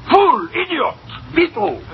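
very high, theatrical outburst; furious insults. Acted. Boss exploding at cashier who gambled with pauper, furious insults. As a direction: separate exclamations, each swooping down.

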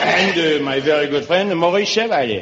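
put-on Frenchman; hammed-up French accent, introducing a dear friend. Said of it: "It is La Belle France".

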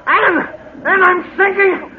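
high desperate scream for help; panicked cry. Acted. Husband sinking in quicksand, screaming to his wife. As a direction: cry out as the ground swallows you.

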